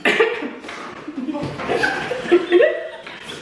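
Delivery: airy giggle; breathy laugh. Real, not acted. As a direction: silent wheezing laugh.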